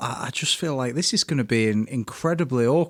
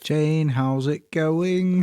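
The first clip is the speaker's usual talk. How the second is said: "Jane, how's it going" slow and higher, lilting; sing-song Welsh; 5 semitones higher, much slower than usual. Shown as a demonstration. Learner trying the sing-song tip, slow lilt. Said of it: "Speak in a sing-song manner".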